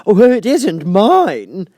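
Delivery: high, loud, slow fluttery Hatter; the Hatter apologizing to the king. Acted. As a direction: play the flustered Hatter.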